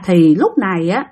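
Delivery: usual speaking voice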